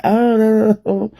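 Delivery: high, whiny pretend dismay; mock distressed oh no. Mocking viewers who expected her to be upset, high fake whine. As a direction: squeal it like you're fake-hurt. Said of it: "y'all thought I was gonna come out here and be like".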